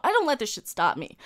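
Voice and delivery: ordinary talk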